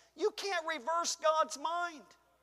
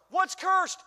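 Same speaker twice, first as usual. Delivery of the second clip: preacher's call; 5 semitones higher, 5 dB louder than usual. Real, not acted. Preacher calls out again.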